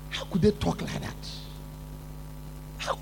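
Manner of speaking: ordinary talk, nothing marked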